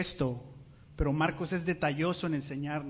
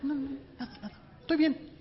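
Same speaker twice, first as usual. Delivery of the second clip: higher, mock-sulky wife insisting she is fine; sulking wife; 7 semitones higher, faster than usual. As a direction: play the upset wife saying nothing is wrong. Wife sulking in marital spat. (esposa enojada fingiendo que está bien, en tono burlón)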